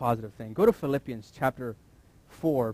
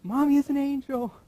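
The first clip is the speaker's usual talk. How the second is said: put-on child's voice; 13 semitones higher, 6 dB louder than usual. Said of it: "Let your little ones just say".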